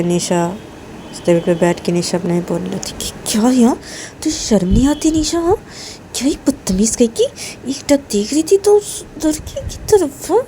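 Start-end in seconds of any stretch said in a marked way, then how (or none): 3.3-10.4 higher-pitched, indignant muttered reproach; embarrassed, exasperated girl; self-scolding girl. A narrator's reading. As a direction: tell yourself off in dismay. Nisha scolds herself.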